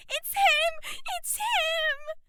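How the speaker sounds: high, loud ecstatic shrieks; fan shriek. A narrator's reading. Dolores spotting the singer, shrieking high and loud. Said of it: "Dolores could be heard above everyone".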